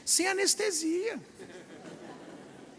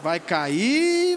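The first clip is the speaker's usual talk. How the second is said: lower, knowing mutter; low muttered warning; 3 semitones lower, 4 dB louder than usual. Retold or imitated. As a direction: mutter it to yourself as he slips. Onlooker watching friend fall. (murmúrio grave e conformado)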